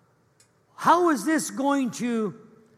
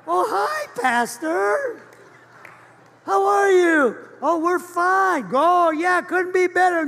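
higher and louder, sugary-bright put-on cheer; fake cheerful greeting; 7 semitones higher, 5 dB louder than usual. Couple caught fighting greets their pastor, gushing fake cheer. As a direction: hide the fight behind a big happy hello.

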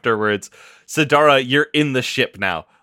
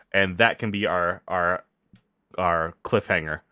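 ordinary delivery

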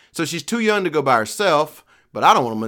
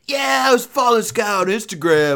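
higher, louder mock rock star; a mock singer voice, higher; 9 semitones higher, 4 dB louder than usual. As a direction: ham up the singer's story. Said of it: "He's like".

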